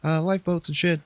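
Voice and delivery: high-pitched mock yell of panicked crew; retold yelling. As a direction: call it out like crew clearing the deck, pitched well up. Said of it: "they all start yelling".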